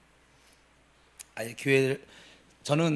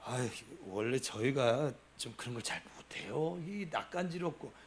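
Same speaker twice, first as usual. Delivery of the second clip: lower, softer, embarrassed mumble; shy husband making excuses; sheepish husband excuse; 6 semitones lower, 11 dB quieter than usual. Retold or imitated. Deacon husband, scratching his head, excusing himself softly. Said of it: "뒷머리 긁으면서".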